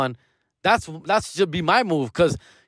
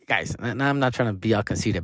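ordinary talk

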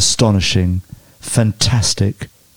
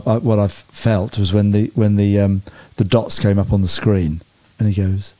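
normal talking voice

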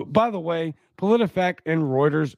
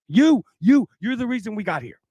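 angry accusation; 7 semitones higher than usual. Real, not acted. Accusing the article writer.

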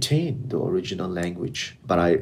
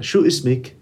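Lebanese dialect, a touch higher; Lebanese Arabic accent; 5 semitones higher, 4 dB louder, much faster than usual. Shown as a demonstration. Interviewer testing guest in Lebanese Arabic accent. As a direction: ask her name the Lebanese way. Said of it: "we're gonna use the Lebanese one".

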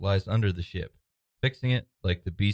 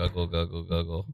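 low gargled alien talk; alien gurgling; 4 semitones lower than usual. Acted. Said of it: "makes gurgling noises".

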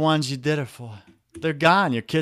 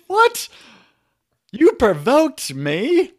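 much higher, louder mock outrage, slow; mock indignant wife; 11 semitones higher, 6 dB louder, slower than usual. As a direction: act the shocked wife, drawn out.

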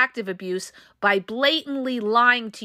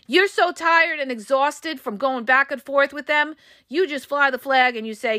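ordinary delivery

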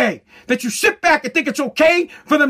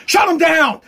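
sudden raised-voice order, cutting in; hammed-up barked command; mock boss's shout; 4 semitones higher, 3 dB louder than usual. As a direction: shout down the objection as a stubborn boss. Acts boss Joe shouting over employee.

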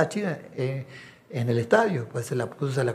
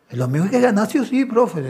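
eager fan urging; 7 semitones higher, faster than usual. Retold or imitated. Fans urging the coach to win.

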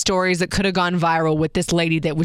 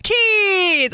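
Teeth much higher and louder, swooping; hammed-up photographer; 11 semitones higher, 4 dB louder than usual. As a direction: belt out Teeth like a showman. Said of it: "big up and down in his voice".

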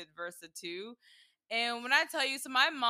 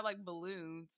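ordinary talk, nothing marked

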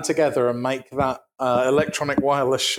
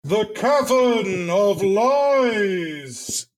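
booming slow roar through a jug; very slow echoing roar, high and hollow; roaring into a water jug; 6 semitones higher, much slower than usual. Acted. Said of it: "me roaring into a water jug".

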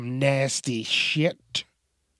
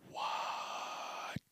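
breathless whisper; 19 dB quieter than usual. Real, not acted. Realizing the joke's dark meaning.

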